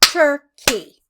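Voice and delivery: Turkey split into two beats, TUR, then KEY; Turkey pulled apart as tur-key, both halves distinct; syllable by syllable. Shown as a demonstration. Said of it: "It's a two clap word".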